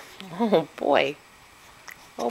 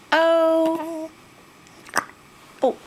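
very high, louder cooing oh; high baby oh; 12 semitones higher, 5 dB louder than usual. Pretend. Mother cooing oh to her baby, very high. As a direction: coo it for the baby.

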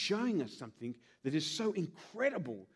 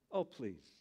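insincere smile; 8 semitones lower, 6 dB quieter than usual. Pretend. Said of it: "Pappy calls that the insincere smile".

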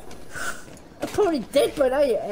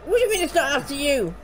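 ordinary delivery

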